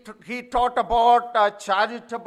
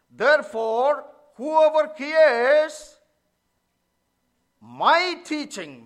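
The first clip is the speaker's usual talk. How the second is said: slow, louder read, landing hard on "my teaching"; stress on my; 2 semitones higher, 5 dB louder, slower than usual. Shown as a demonstration. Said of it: "my is in the emphatic position".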